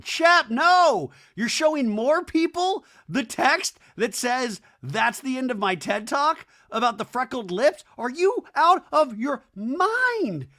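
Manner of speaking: high, loud disbelief; hammed-up outraged shout; exasperated scolding. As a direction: yell it slowly like he has lost it. Host scolds Shep in disbelief.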